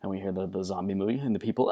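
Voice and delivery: ordinary talk, nothing marked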